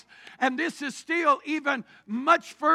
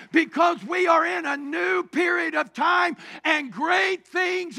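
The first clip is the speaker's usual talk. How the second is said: loud, higher preacher's call to the church; rally the congregation; fervent sermon build; full-force preaching; 3 semitones higher, 5 dB louder than usual. Acted.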